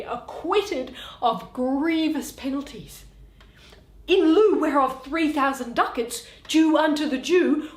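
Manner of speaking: Shakespeare read aloud, every part voiced in turn; theatrical reading of a courtroom scene; dramatic play reading. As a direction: read the scene like a stage performance. Reads all parts of the play aloud.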